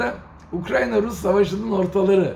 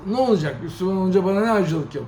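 usual speaking voice

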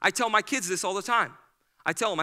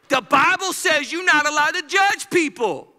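high, loud mocking laugh; mock the misquote; mocking claim; 4 semitones higher, 6 dB louder than usual. Mocking someone who misquotes the Bible, with a laugh. Contrasted with correction.

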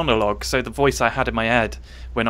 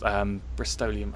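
ordinary delivery

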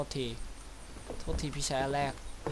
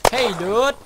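higher, louder hey dude; excited call; 6 semitones higher, 8 dB louder than usual. Real, not acted. Gamer calling out to the game character, excited. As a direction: call out to a buddy, pumped. Calls out on spotting a gun.

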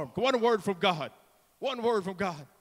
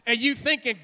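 ordinary talk

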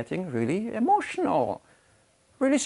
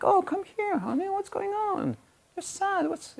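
higher and softer, soothing; tender comforting; 6 dB quieter than usual. Retold or imitated. Man comforting a sad partner, softer and higher, tender. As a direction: coax her gently like consoling a loved one. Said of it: "you go like".

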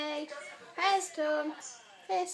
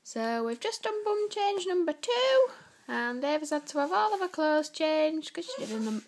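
ordinary delivery

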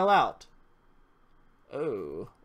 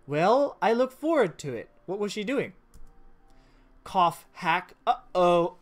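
ordinary delivery

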